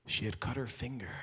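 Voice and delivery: usual speaking voice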